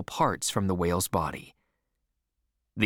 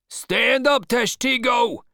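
very high, loud shouted order; cried out; 14 semitones higher, 5 dB louder, slower than usual. A narrator's reading. Boat leader to his harpooner, crying out. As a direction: yell it down the boat. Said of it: "cried Stubb".

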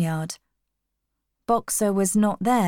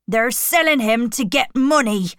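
higher, indignant outburst; angry warning; 4 semitones higher, 4 dB louder than usual. A narrator's reading. Benjamin tells the animals of the betrayal, indignant.

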